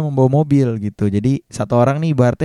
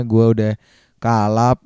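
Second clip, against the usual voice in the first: ordinary talk, nothing marked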